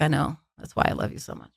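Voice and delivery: soft, low imitation of a creep; hushed, eerie declaration of love; really creepy. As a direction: let it sink to a near whisper, creepily. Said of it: "really creepy, actually".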